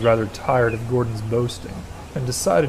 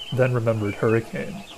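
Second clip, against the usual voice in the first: plain reading voice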